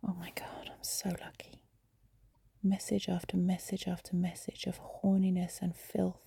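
ordinary talk, nothing marked

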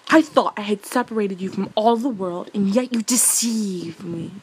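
theatrical outrage at being deceived; enchantress's outraged cry. A narrator's reading. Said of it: "cried the enchantress".

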